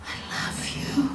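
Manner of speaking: breathy hushed whisper of love to a sick mother; soft whisper. Retold or imitated. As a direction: barely voice it, tender and close.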